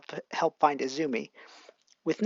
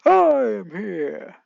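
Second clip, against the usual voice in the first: slow, booming heroic entrance; All Might; 3 semitones higher, 7 dB louder, much slower than usual. Acted. Hero bursting in on the scene, slow and booming. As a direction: announce yourself like the top hero. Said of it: "All Might running in saying".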